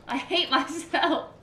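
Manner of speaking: laughing through loud, high words; jump scare laugh. Real, not acted. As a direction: laugh it off after the fright.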